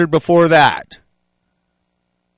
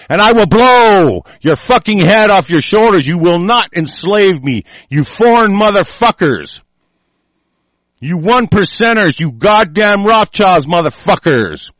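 louder and higher, roaring the threat; furious shout; 4 semitones higher, 4 dB louder than usual. Real, not acted. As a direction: bellow it at the enemy like a challenge.

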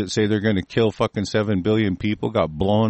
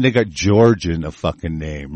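ordinary talk

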